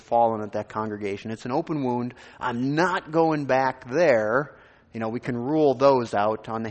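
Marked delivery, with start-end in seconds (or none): 2.4-4.6 slow, louder, every word leaned on; firm refusal. Retold or imitated. A pastor recalling why he refused that church, slow and firm. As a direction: spell out each word like a door shutting.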